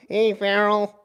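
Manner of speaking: high-pitched silly buddy call; squad's put-on voice. Shown as a demonstration. Showing how we talk, calling a buddy in high mock voice. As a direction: demonstrate the high joke voice. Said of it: "Oh, that's how we talk".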